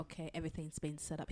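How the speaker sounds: soft, low-key imitation of a whisper; retold whisper. Retelling a tip-off at a funeral, half-whispered. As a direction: drop your voice as if whispering in church. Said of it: "He just whispered it".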